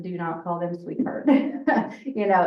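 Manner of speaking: ordinary talk, nothing marked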